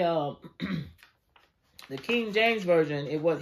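ordinary talk, nothing marked

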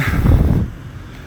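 unvoiced breathy laugh; real laugh. Real, not acted. Comic recalls pushing the man, breathy laugh. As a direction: laugh breathily at your own story. Laughs while telling the story.

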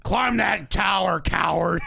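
slow barked drill command; drill sergeant. Pretend. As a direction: bark it like a tough gym coach. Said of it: "Alex Jones being a P".

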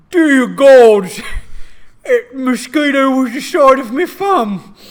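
loud, high Cockney British orc voice; an orc griping in a booming Cockney accent. Acted. Orc slaps a mosquito in loud Cockney. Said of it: "orcs in this world have a very Cockney British accent".

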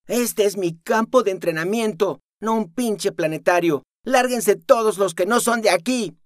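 high, loud furious command; angry order. Acted. The girl ordering everyone out, angry. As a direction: drive them off.

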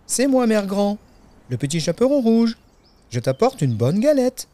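put-on high, childlike announcement at the door; little girl calling. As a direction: call out as an eager little girl. Girl calls at grandmother's door.

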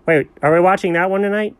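ordinary talk, nothing marked

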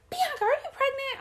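very high imitated amazement; astonished stepmom. Stepmom asking Bianca if she's pregnant, imitated astonishment. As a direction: ask it way up high, mock-amazed.

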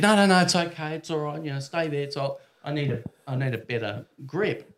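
high, loud protest easing as it goes on; flustered refusal. Retold or imitated. Teen plumber stopping naked customer getting up, flustered. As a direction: hurriedly wave her off, starting high and loud.

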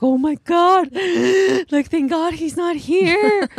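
high gasping fright, slow relief; mother reliving a dropped knife, frightened; freaked out. Retold or imitated. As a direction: retell the scare with a gasp. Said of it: "I freaked out".

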